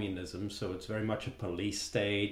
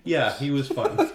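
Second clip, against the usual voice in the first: laughing loudly, high squeal; cracking up at the memory; 5 semitones higher, 8 dB louder than usual. Real, not acted. Laughing about the villain.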